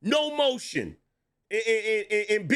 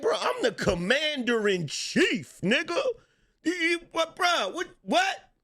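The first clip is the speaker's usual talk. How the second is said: mock outrage; 8 semitones higher than usual.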